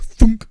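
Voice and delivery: cork pop imitation. Game master pulls the cork, loud imitated pop. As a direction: punch out the cork's pop as a noise.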